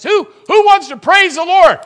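loud, high shout; shouting preacher. Real, not acted. Preacher calling on the congregation to praise. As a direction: shout it out to the church.

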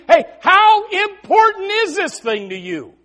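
high, loud, stretched-out question; emphatic challenge. Retold or imitated. Jesus tests whether you really believe, emphatic loud challenge. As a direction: challenge them, leaning on each word.

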